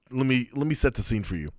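usual speaking voice

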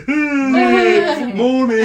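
high, loud singled-out greeting; hammed-up morning call; breezy morning greeting. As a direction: call it out with exaggerated innocence. Mock casual entrance down the stairs.

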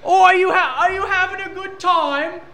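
put-on high shrieking British baby talk; British accent scream. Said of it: "scream at [name] in a British accent".

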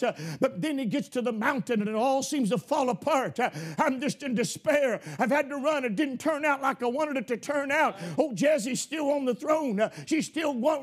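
ordinary talk, nothing marked

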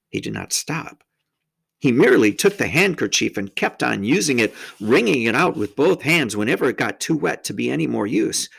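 ordinary delivery, nothing marked

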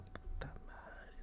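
slow whispered curse of dismay; slow, voiceless curse as the soup spills. Retold or imitated. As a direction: breathe it out in despair.

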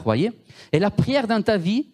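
ordinary talk, nothing marked

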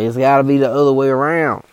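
put-on high, loud old hiker bragging; hammed-up high old man; old guy voice. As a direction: put on the old-timer boasting. Old guy voiced in hiking story.

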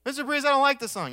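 high, loud, hurried pupil protest; quick imitation of a complaining student. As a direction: rattle off a mock student complaint. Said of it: "It's always what he'd say".

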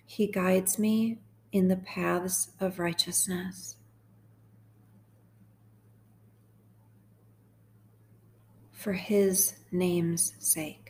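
slow, measured psalm reading; guided meditation. Real, not acted. Host guiding sad listeners through Psalm twenty-three, slow meditation. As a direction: read each phrase slowly, leaving room to repeat it.

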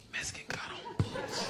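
imitating a secretive whisper; whispered warning. New inmate tells somebody else, whispering. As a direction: pass it on under your breath, whispered.

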